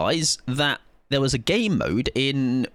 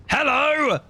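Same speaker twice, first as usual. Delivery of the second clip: high mock greeting; 10 semitones higher, slower than usual. Killer greeting a survivor in the basement, high cheery voice. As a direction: greet them like a cheery host.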